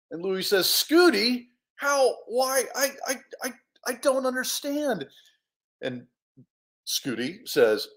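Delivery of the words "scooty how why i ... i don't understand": high, stammering confusion; bewildered disbelief. Acted. Hero recognising his hamster as a bear, bewildered stammer. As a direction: stumble over the words in disbelief.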